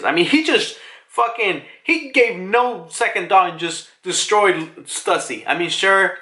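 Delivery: usual speaking voice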